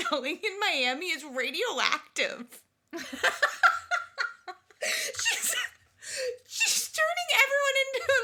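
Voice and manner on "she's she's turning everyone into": high giggling, words breaking; laughing. Real, not acted. Host laughing about radioactive seashells, high and breaking.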